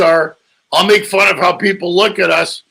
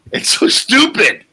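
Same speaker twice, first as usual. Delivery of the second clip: normal talking voice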